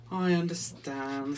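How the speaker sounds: slow, resigned sigh of a duck; slowly, a little deflated. A narrator's reading. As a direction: draw it out with a mild sigh. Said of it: "sighed Quentin".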